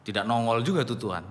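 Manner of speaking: usual speaking voice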